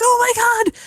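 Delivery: high-pitched, acted alarm; retold panic. Dreamer hanging off a cliff edge, mock panic. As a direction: replay a panicked cry from a dream.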